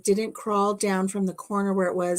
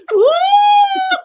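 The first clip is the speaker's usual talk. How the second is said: very high, loud shrieking yell; panicked yell; 14 dB louder than usual. Retold or imitated. Wife yelling while running through the house. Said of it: "running through the house going".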